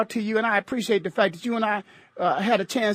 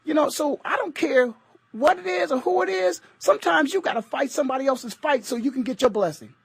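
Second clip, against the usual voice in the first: ordinary delivery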